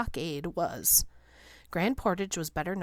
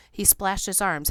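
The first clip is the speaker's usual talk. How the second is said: ordinary delivery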